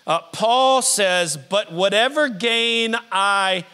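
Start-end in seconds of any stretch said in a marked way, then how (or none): 1.4-3.7 slow, weighty scripture read; slow measured reading. Acted. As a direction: lay each word down slowly.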